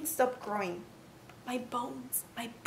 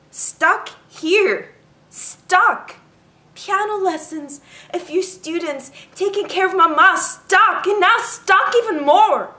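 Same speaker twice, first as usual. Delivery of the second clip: angry outburst; 4 semitones higher, 9 dB louder, slower than usual. Acted. Building angry outburst, stuck.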